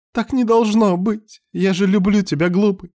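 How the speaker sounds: high, anguished voice; horrified pleading. A narrator's reading. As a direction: plead desperately, pitched very high. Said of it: "с ужасом в дрожащем голосе".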